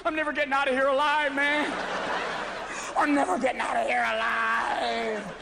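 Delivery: mock terror stuck in the sand; paranoid panic. Paranoid golfer in the sand trap, mock panic.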